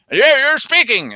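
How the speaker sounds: high, loud accusing outburst; mock accusation. Official catching the host supposedly talking, loud mock accusation. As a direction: imitate someone pouncing on a culprit.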